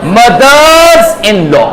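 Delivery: slow with stress on mother's; stressed plural. Shown as a demonstration. Teacher stressing the plural mother's for the class. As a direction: lean on the s of mother's, slowly. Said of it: "इसका plural बनेगा".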